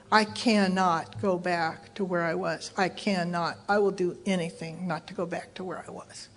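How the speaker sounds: low, soft and deliberate; quietly desperate determination, each phrase weighed; grave resolve. Retold or imitated. She hears her sponsor's warning on the phone, grave resolve.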